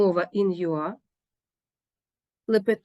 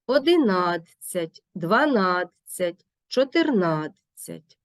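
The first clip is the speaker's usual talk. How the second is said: slow одинадцять, дванадцять, чотирнадцять stressed on НАД; penultimate stress; slower than usual. Shown as a demonstration. Teacher giving examples in адцять, slow stress on НАД. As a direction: stretch НАД in each numeral. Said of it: "наголошений передостанній склад".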